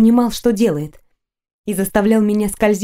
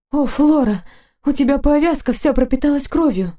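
higher-pitched worried exclamation; alarmed concern; 5 semitones higher than usual. Acted. Heroine sees blood on the knight's bandage, high alarmed cry. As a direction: pitch it up in dismay. Alarm at his bleeding wound.

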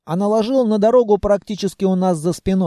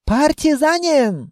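high, slow mock yell; drawn-out accented call of enemy soldiers; straining elves; 9 semitones higher, slower than usual. A narrator's reading. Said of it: "надрывались светлые". (надрывно зовущие эльфы)

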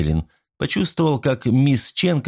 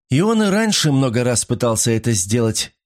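plain reading voice

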